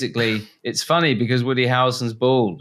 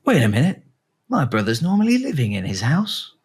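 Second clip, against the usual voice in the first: surprised realization; 6 semitones higher than usual. Retold or imitated. Voicing the detective character.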